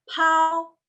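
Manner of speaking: "Pau" high and long, P puffed with air; aspirated P. Shown as a demonstration. Teacher modelling pakai aspirasi, puffed high "Pau". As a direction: let a burst of breath open "Pau". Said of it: "tapi pakai aspirasi".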